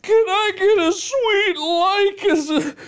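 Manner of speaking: high, loud tearful plea; mock sobbing. Streamer begging a farewell for the bow, mock-crying. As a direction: wail it through sobs and laughter.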